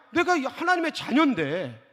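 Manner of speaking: ordinary talk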